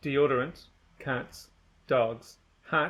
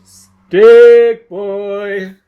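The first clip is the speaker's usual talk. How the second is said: much louder, higher yell, Stick held long and level; long drawn-out yell; 6 semitones higher, 20 dB louder than usual. Acted. Someone yelling for Stick Boy from far off, long drawn-out yell. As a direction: bellow it across the whole town. Repeated call, longer and louder.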